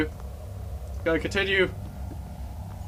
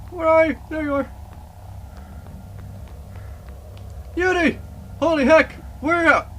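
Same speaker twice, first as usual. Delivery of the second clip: high, anxious calling out; scared horror game streamer; 12 semitones higher than usual. Real, not acted. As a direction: call out nervously in the dark.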